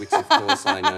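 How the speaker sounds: laughing through the words. Real, not acted. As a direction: burst out laughing mid-sentence. Laughs at his own story.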